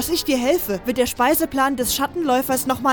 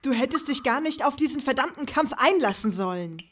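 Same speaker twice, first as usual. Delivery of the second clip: sighing reproach; 6 dB quieter than usual. Acted. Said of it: "und seufzte".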